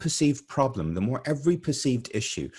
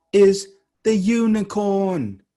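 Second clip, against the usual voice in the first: amazed; 8 semitones higher, 9 dB louder, slower than usual. Retold or imitated. Repeats the astonishing trivia answer.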